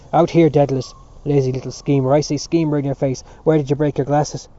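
loud, hectoring reprimand; angry scolding. A narrator's reading. Prefect calling out Dedalus over broken glasses, scolding loudly. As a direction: bear down on the culprit, loudly.

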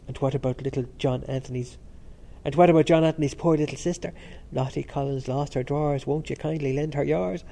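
ordinary delivery

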